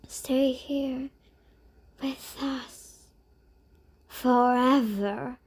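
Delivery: whispered, very slow haunting call; ghostly whisper. A narrator's reading. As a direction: breathe it out like a spirit. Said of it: "called two ghosts together".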